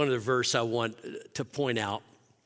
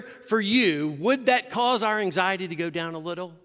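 higher and louder pulpit voice building up; preaching at full force; 6 semitones higher, 4 dB louder than usual. Acted. Pastor urging the anxious church, full-force preaching. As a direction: press the promise hard on the congregation.

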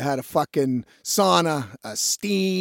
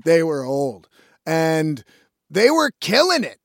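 normal talking voice

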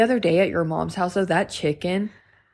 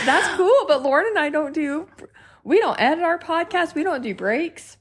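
mock reply; 7 semitones higher than usual. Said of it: "I'm like".

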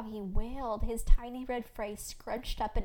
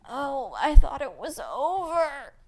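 loud, slow wail with swooping pitch; pained groan; 5 semitones higher, 12 dB louder, slower than usual. A narrator's reading. Labouring mother protests another pang, groaning loudly. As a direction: groan it out through a pang of pain. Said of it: "I groaned as another pang pulsed".

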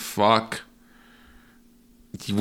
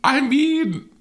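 high, squeaky embarrassed dodge; embarrassed squeal; 4 dB louder, slower than usual. Real, not acted. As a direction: squeal it out, caught out.

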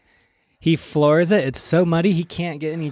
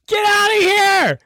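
high-pitched yell to scare someone off; alarmed high holler; retold shout; 4 dB louder than usual.

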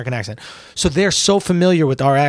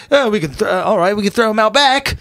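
higher-pitched mock English accent; imitated English accent; 8 semitones higher, 4 dB louder than usual. Comic imitating English people telling a joke, mock English accent. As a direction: caricature English people in a raised voice. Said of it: "like English people, when they're saying something".

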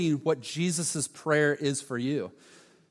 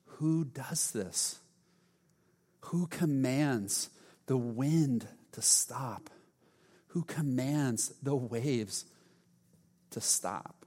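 slow, much softer hushed whisper; awed whisper; 4 semitones lower, 6 dB quieter, much slower than usual. Retold or imitated. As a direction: whisper in stunned disbelief. Disciples stunned after storm stops.

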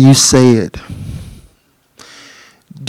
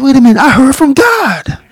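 high, shouted thrill of discovery; much higher, shouting with amazement; excited realization; 12 semitones higher than usual. Retold or imitated. Prisoner realizing he truly heard God, shouting excitedly. Realizes prayer was answered.

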